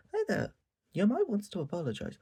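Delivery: very high, bright sing-song greeting; chirpy Najimi intrusion. Retold or imitated. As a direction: barge in like a cheerful busybody. Voicing Najimi butting in.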